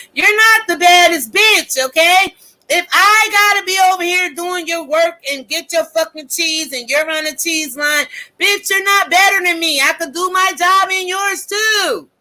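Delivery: high, loud furious yelling at him; retold yelling. Yelling at the coworker who thinks he's the best. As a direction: shout him down, pitch way up.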